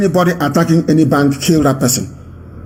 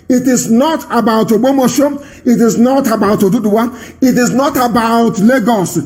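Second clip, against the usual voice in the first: higher, insistent litany of names, each hammered; fiery preaching; 6 semitones higher than usual. Acted. Broadcaster rallying Nigerian youths, fiery preaching. Rousing chant of place names.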